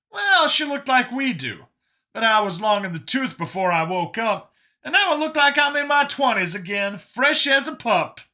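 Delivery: high and perky, delighted with regained youth; Brian, upbeat rejuvenated survivor. Acted. Brian boasting of his youth to Vincent, high and upbeat.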